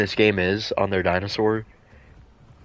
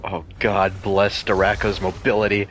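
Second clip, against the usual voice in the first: normal talking voice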